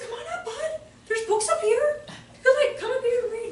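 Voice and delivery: high-pitched coaxing call; cheery invitation. Retold or imitated. Teacher inviting a boy up to the loft, high coaxing call. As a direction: call up to a child, bright and high.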